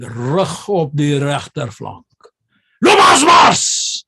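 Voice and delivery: slow drawn-out order ending in a loud high yell; Afrikaans drill command. Retold or imitated. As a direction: drag the words then bellow the march.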